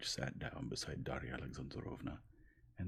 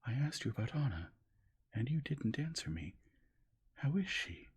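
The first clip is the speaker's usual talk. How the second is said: higher, louder anxious question; urgent Dolly; 9 semitones higher, 4 dB louder than usual. A narrator's reading. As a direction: press him for an answer. Dolly pleading in story.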